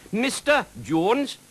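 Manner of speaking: very high, slow, insistent correction; correcting dragon. A narrator's reading. Dragon corrects how the knight addresses him, insistent. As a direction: draw out MISTER Jones high up.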